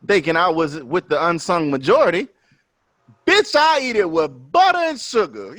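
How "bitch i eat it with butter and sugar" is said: high, emphatic retold retort; playful high-pitched boast; mock indignant. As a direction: hit it like a proud confession. Retelling his grits answer.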